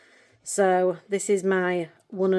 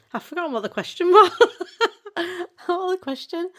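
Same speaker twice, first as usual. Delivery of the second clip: higher and rushed, laughing through the words; flustered laugh; 11 semitones higher, 5 dB louder than usual. Real, not acted. Lost her question after crying on camera, giggles it out. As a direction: giggle as she loses the thread. Loses track while emotional.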